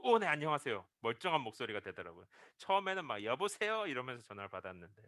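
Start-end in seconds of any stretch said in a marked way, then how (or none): 0.0-0.9 instantly straightened-up, louder polite greeting; suddenly sober voice. Retold or imitated. 술 취한 청년이 전도사 전화에 정신 차리며, 갑자기 또박또박 공손하게. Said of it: "갑자기 목소리 힘 빡 주고". 3.2-3.9 high, loose phone answer over party noise; loose party hello. Retold or imitated. 파티 중인 청년이 전화를 받으며, 들뜬 높은 목소리로.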